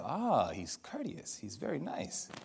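hushed, slow private musing; barely audible aside to himself; quiet inner thought. Retold or imitated. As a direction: say it under your breath, impressed. Inner thought about kind stranger.

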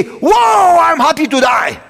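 loud, high cry of sudden joy; joyful outcry. Retold or imitated. Preacher acting out a believer's joyful shout. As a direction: shout it like you just glimpsed heaven.

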